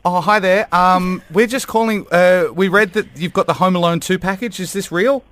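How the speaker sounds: bright, loud polite phone voice; overly chirpy caller on the phone; customer service voice. Pretend. Calling the hotel reservations.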